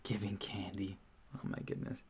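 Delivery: soft, whispered aside; hushed murmur. Real, not acted. Streamer murmuring to self between remarks, hushed whisper. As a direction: say it under your breath, barely voiced.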